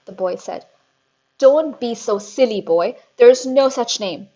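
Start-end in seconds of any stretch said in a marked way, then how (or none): 1.4-4.3 loud, scornful dismissal; scornful shout. A narrator's reading. Headmistress scorning Eric's name, very loud. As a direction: slap the idea down loudly. Trunchbull scolds.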